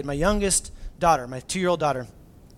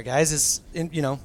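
normal talking voice

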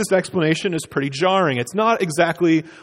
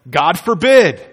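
"God forbid" called out louder; exclaimed; 7 dB louder than usual. Shown as a demonstration. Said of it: "with an exclamation point".

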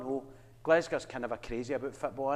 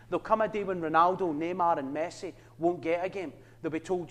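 normal talking voice

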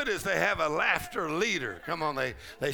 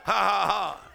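loud staged ha ha ha; mock triumphant laugh; 4 semitones higher, 5 dB louder than usual. Shown as a demonstration. Pastor showing how to laugh at the devil, loud mock laugh. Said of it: "you just laugh at every strategy".

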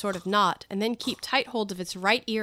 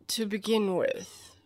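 slow, languid cat voice; Cheshire Cat; slower than usual. Acted. The cat begins its proof for Alice, languid. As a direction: draw the opening out.

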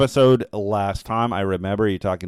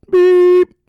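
loud high beep sound; beeping out a name; 13 dB louder than usual. Pretend. Host censoring a listener's name with a loud high beep. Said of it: "I'm beeping out names".

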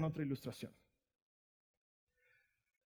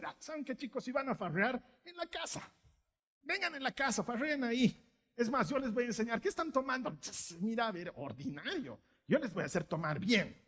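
a preacher mocking parents who host teen drinking; mocking permissive parent; 3 semitones higher, 4 dB quieter than usual. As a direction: play the too-cool parent, lightly mocking.

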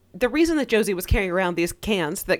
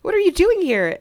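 mock shocked Kevin; 10 semitones higher than usual. Kevin's shocked outburst retold.

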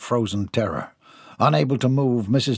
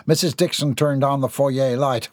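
plain reading voice

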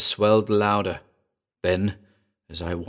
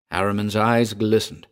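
plain reading voice

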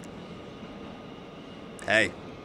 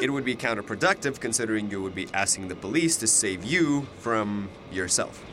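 normal talking voice

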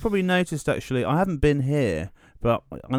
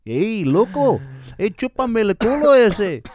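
high, loud, hammed-up Spanish taunt; mock Spanish accent; 8 semitones higher, 4 dB louder than usual. Clowning for his co-host, exaggerated Spanish accent. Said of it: "she sounds kinda Spanish".

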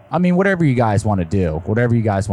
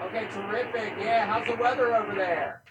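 far from mic; 10 semitones higher, 11 dB quieter than usual. Real, not acted. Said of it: "you're still kind of faint".

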